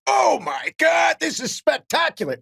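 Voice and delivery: high, drawn-out mock shout of delight; imitating a friend's delighted shriek; gleeful outburst. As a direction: crow with glee, way up in pitch. DJ gleefully reacts to the mishap.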